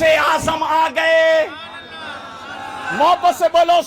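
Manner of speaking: high, ringing sermon shout; impassioned, high-pitched preacher's call; full-force preaching. Acted. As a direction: build to a rousing cry for the crowd.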